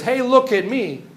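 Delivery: mock boasting. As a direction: imitate someone shouting for attention. Scientist showing off, as before.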